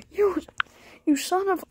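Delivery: high, indignant outburst cut off; mock outrage. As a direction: start a play insult in high-pitched outrage. Pet gets mad.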